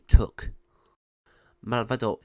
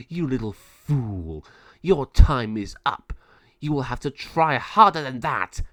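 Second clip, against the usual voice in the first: slow taunt framed by evil cackles; cackle, then relish each word, building louder; gloating villain; 2 semitones higher, 5 dB louder than usual. Acted. Malvado gloating over captured Mariana, cackling.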